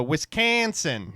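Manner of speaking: WISCONSIN drawn out with a flat "can" in a Wisconsin accent; exaggerated Wisconsin accent. Shown as a demonstration. Host showing how locals say it, exaggerated Wisconsin accent. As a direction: hit "Wisconsin" long and loud in exaggerated Wisconsin accent. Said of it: "You can exaggerate it to shit".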